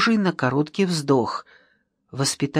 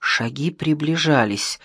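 usual speaking voice

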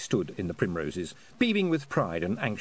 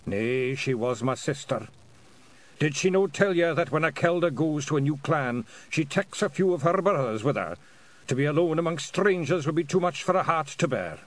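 put-on lightly Scottish old pixie; old Feagle; 5 semitones higher than usual. Feagle explains, Scots.

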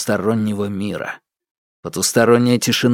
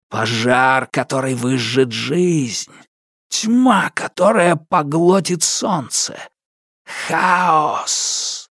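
slow, higher menacing spirit; ominous darkness voice; 4 semitones higher, slower than usual. Acted. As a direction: intone it like a dark spirit. Darkness speaks as a figure.

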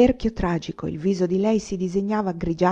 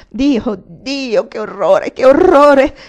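building to a high, full shout of horror; desperate horror; 5 semitones higher, 11 dB louder, much slower than usual. A narrator's reading. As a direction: cry out in despair, louder each time. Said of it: "intrecciando disperatamente le dita".

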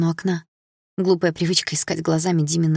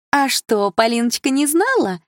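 put-on higher sugary chirp of an elderly lady; chirping old woman; 7 semitones higher, slower than usual. Elderly relative to the daughter, sweet chirping. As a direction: coo it sweetly, a little spiteful. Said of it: "прощебетала она".

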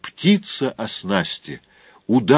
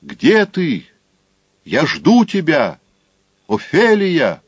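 calling out; 5 semitones higher, slower than usual. Acted. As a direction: call out across the square. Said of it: "и позвал".

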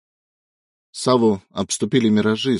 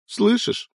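high, rising tearful plea; pleading cry. Acted. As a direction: beg him to hear you. Son screams over dead father.

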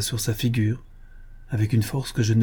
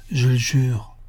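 ordinary delivery, nothing marked

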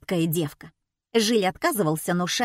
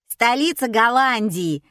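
louder, higher call from the crowd; yelled question; 4 semitones higher, 5 dB louder than usual. A narrator's reading. Spectator testing the assistant, yelling. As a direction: yell it out across the hall. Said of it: "заорал кто-то".

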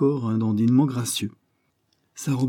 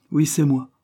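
higher, louder, coolly dismissive; a chilly, higher "Oui, c'est moi"; cold reply; 6 semitones higher, 4 dB louder than usual. Acted. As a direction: brush him off, cold as ice. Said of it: "avec autant de froideur".

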